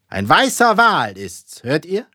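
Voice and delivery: slow, loud captain's cry; emphatic repetition. A narrator's reading. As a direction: drive it home to the crew. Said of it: "wiederholte Ahab".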